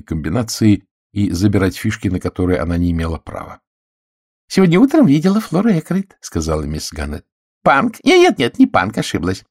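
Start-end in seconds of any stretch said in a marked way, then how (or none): 4.5-6.2 high, loud eager spinster voice; gossipy spinster. A narrator's reading. Miss Gannett blurts out her news, high and loud. 7.6-9.4 high, loud fluster at her own mistake; flustered spinster. A narrator's reading. Miss Gannett takes back her call, high and flustered.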